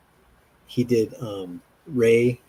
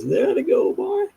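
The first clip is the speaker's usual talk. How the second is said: high silly voice; slower than usual. Retold or imitated. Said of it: "they're like".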